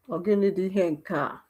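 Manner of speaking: ordinary delivery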